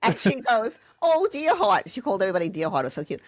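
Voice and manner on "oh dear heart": high, louder imitation of a doting teacher; fussing teacher. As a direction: coo it high, as the teacher would. Imitates teacher gently scolding her.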